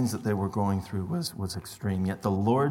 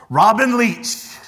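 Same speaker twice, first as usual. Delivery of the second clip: Robin Leach; 11 semitones higher, 9 dB louder than usual. Retold or imitated. Preacher does a bad Robin Leach impression for the congregation, high and loud. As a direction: boom it out like a showy English TV host. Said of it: "I am the worst at accents".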